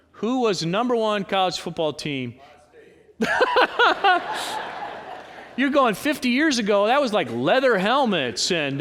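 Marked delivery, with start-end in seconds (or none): 3.2-5.5 laugh. Real, not acted. Preacher laughing at old football.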